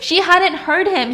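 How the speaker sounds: high, loud burst of disbelief; loud exclamation. Real, not acted. Storyteller reveals the twist to a listener, loud exclamation.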